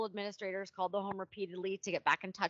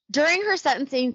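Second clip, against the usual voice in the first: loud, high emphatic start; 6 semitones higher, 12 dB louder than usual. Real, not acted. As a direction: announce it with force. Sudden emphatic restart.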